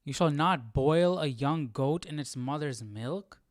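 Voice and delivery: ordinary talk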